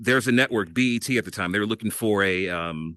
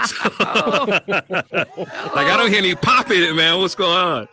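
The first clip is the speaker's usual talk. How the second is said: laughing through a quick, loud, higher retelling; 7 semitones higher, 4 dB louder, slower than usual. Retold or imitated. Laughing while telling hosts how critics mocked his demo. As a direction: crack up while quoting the critics.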